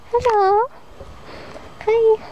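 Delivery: high sing-song pet voice; talking to sheep. Real, not acted. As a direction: coo a greeting to the animals. Greeting the sheep in the field.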